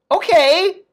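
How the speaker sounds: high bright okay; mock cheery okay. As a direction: chirp it off carelessly. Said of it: "then it's like".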